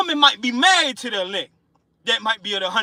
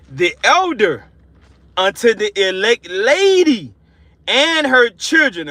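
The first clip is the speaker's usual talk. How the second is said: slow, louder proclaimed verse; scripture reading; 4 dB louder, slower than usual. Acted. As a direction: announce the verse with rising force. Reads scripture aloud.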